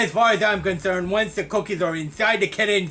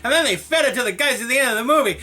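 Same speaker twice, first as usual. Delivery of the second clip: ordinary delivery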